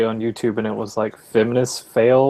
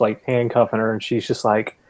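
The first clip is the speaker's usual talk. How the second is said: ordinary talk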